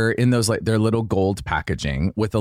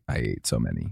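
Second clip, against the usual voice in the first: ordinary talk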